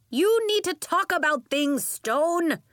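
high, loud telling-off; yelling. A narrator's reading. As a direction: yell it up at the father. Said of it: "she yelled to Nori's father".

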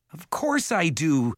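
high, drawn-out yell. A narrator's reading. Jared to his false father, yelling. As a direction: yell it back in protest. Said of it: "Jared yelled".

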